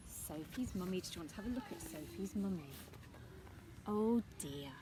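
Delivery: usual speaking voice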